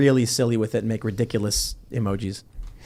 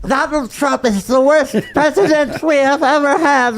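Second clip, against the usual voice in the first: high slobbery mockery, dentures out; exaggerated toothless politician caricature; Nancy Pelosi; 12 semitones higher, 3 dB louder than usual. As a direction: slur it high and wet, mocking her. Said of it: "A slobbery caricature".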